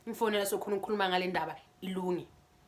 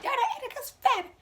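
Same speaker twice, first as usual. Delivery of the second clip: high yell of her name, in an odd voice; a high, mocking shout of her name. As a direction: call her out mockingly from afar. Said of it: "yells my name".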